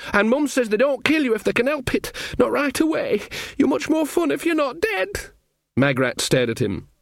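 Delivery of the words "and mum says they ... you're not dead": put-on high-pitched panicky young soldier voice; frightened Sean Ogg. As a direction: blurt it out high, reporting to the queen. Narrator voices frightened Sean in a story.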